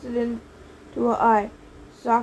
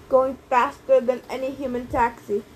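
plain reading voice

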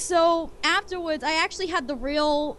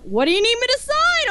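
loud, high retold question; eager offer; 10 semitones higher, 6 dB louder than usual. Celebrity at autographs asking a fan, eager. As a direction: offer eagerly, voice lifted and strong.

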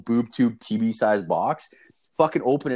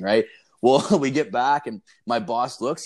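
ordinary talk, nothing marked

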